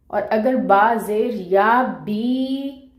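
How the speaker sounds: बी held for two beats, slow; recited slowly, ई drawn long; two-beat madd. Shown as a demonstration. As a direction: stretch बी out evenly. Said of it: "दो हरकत लंबा पढ़ेंगे".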